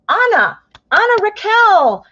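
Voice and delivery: light up as you call out her name; excited name call. Real, not acted. Reader greets donor Anna in chat, delighted calling.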